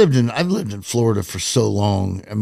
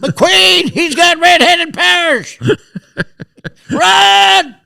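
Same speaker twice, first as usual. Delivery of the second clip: much higher, louder joking yell; laughing mock alarm; mock panic shout; 5 dB louder, much slower than usual. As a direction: cry run like a cartoon panic. Joking cry about McQueen.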